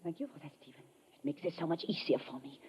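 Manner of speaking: very soft, low and cold; cold hush. Acted. Spy speaks softly to the man she means to kill, cold hush. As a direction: let it fall to a chilling near-murmur. Killer prepares to shoot lover.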